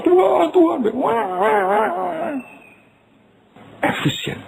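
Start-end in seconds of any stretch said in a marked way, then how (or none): 0.0-2.4 high, frantic babbling cry to God; mock frantic prayer. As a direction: parody a careless, panicky prayer, pitched way up. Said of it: "Nggak ngawur".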